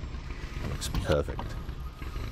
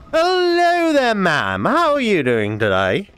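lightly posh English greeting, high start; slow, high hello in a mildly posh voice; 8 semitones higher, 3 dB louder, slower than usual. Acted. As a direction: greet the customer with a hint of posh. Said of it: "Don't know why I've gone on posh now".

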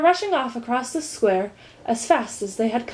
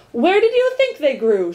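higher, louder, exasperated challenge; irritable shout; 7 semitones higher, 6 dB louder than usual. A narrator's reading. Earl rebukes Milo's ignorance, irritable shout. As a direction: snap it back with irritation. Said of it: "shouted the earl irritably".

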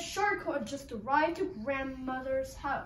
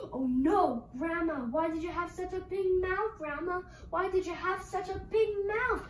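high, alarmed questioning; mock alarm; 5 semitones higher than usual. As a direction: play Red startled, pitch climbing. Red alarmed by wolf's mouth.

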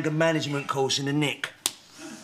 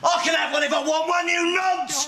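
high, fast, defensive shout; fast, high-pitched angry retort; angry outburst; 10 semitones higher than usual. Acted. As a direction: shout back in indignation. Snaps at doubt about his accent.

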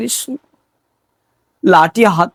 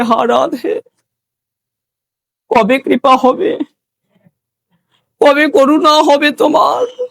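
acted lament climbing higher line by line; tearful plea; 7 semitones higher than usual. Raghunath begging Radha for mercy, tearful pleading. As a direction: plead with a touch of weeping. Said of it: "আর কেঁদে কেঁদে বলছে".

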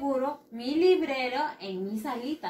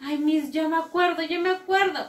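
lightly voiced excited pupil, higher and louder; eager pupil; 5 semitones higher, 5 dB louder than usual. Pupil eagerly telling Miss they remember, excited. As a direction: play a keen child piping up.

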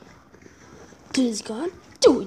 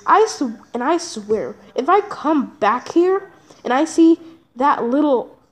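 ordinary talk, nothing marked

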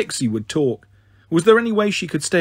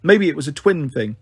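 ordinary delivery, nothing marked

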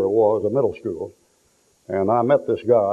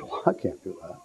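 quiet; 5 semitones lower, 10 dB quieter than usual. Retold or imitated. Son refusing to whip mother.

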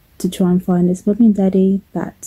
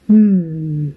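long savouring "hmm"; drawn-out appreciative hum; contented hum; 3 semitones lower than usual. Acted. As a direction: hum with slow, falling pleasure. Bird savours the fresh smell.

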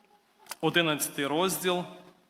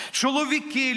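preacher addressing the men, raised call; 7 semitones higher than usual. Acted. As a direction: summon the men from the pulpit.

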